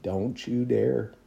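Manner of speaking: slow, quiet, stern warning; God warning him off the bread. Retold or imitated. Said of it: "he's like".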